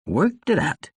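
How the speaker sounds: put-on slurred, rambling drunk; lightly voiced tipsy ambassador; drunk man voice. Drunk Tsar repeats himself.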